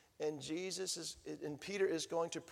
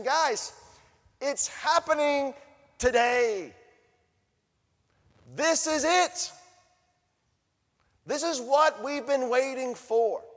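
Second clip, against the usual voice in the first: higher, louder, thrilled announcement; excited Peter; 8 semitones higher, 10 dB louder, slower than usual. Acted. Peter telling the crowd the great day has come, excited. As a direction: tell the crowd it's finally here.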